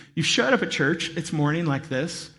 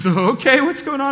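alarmed question; 8 semitones higher, 6 dB louder than usual. Retold or imitated. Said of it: "I was like".

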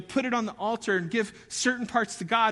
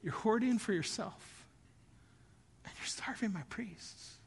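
much softer, letting it sink in; hushed repetition; 11 dB quieter than usual. Shown as a demonstration. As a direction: drop almost to a hush on starving. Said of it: "listen to this".

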